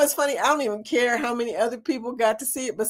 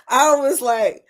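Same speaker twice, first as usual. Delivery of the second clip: ordinary talk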